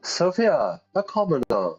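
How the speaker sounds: high, refined posh lady. Acted. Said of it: "With a very posh voice".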